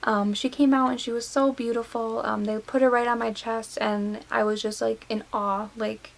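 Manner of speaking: ordinary talk, nothing marked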